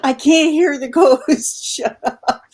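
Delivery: mock yell at screen. Woman recalling yelling at ghost hunters on TV, laughing mock yell. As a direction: yell it half-laughing, pitch jumping up. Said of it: "I yelled at the screen".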